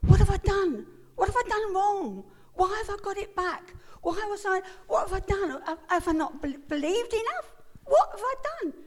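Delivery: high, loud anguished self-blame; desperate pleading. Retold or imitated. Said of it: "I blamed myself".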